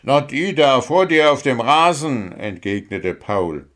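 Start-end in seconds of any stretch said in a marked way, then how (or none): 0.0-2.3 higher, exasperated explanation; impatient. A narrator's reading. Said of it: "wurde langsam ungeduldig".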